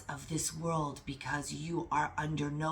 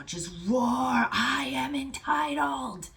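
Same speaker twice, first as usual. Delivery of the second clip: loud, high, slow declaration from the chest; roaring; 9 semitones higher, 5 dB louder, slower than usual. Real, not acted. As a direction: roar it like a lion. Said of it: "That's how I feel right now".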